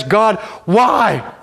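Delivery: high, loud cry of why; fist-shaking cry. Acted. Job shaking his fist at God, loud and high. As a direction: hurl it up at heaven. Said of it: "shake his fist in the face of God and he says".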